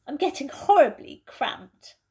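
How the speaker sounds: Susan's voice. Acted. Susan, cramped in the wardrobe, complains impatiently. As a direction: voice a fidgety girl stuck in a wardrobe.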